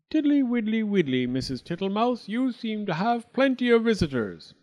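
a greedy toad guest, all fawning thanks; high singsong toad; Mister Jackson the toad. Acted. Toad in the larder.

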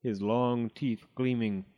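usual speaking voice

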